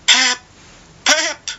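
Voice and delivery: high desperate calls; boy crying out. A narrator's reading. Boy calling his father after the shots, high cry.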